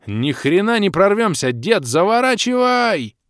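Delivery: loud, high, slow desperate yell; panicked shout. Acted. Hero yelling at the old man to turn away, loud and high.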